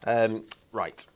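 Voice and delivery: ordinary talk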